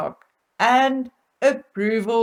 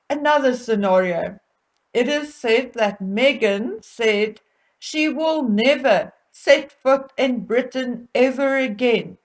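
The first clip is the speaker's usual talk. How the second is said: usual speaking voice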